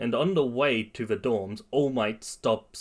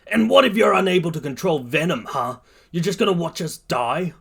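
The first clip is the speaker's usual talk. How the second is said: higher, louder accusing voice; angry challenge; 6 semitones higher, 5 dB louder than usual. Acted. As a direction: confront them heatedly. Bakugo confronts Midoriya in shouting match.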